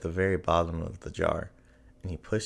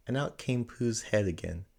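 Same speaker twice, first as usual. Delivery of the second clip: ordinary delivery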